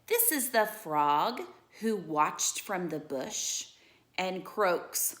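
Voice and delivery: lively storybook read-aloud; animated bedtime-story reading; reading to children. Acted. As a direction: read it like a picture book for kids.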